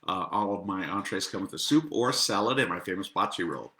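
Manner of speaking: ordinary talk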